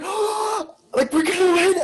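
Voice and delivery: very high, loud hopeful cry; excited crowd. Retold or imitated. Crowd of fans cheering before the buzzer beater. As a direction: cry out as the winning shot flies. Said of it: "everybody's like".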